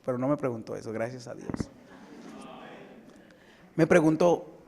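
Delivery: ordinary delivery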